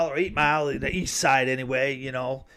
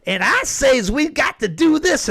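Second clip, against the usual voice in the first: much higher, louder put-on folksy voice; mocking Kamala; 11 semitones higher, 5 dB louder than usual. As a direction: squeak it out as an over-the-top mock of her. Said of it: "She's like".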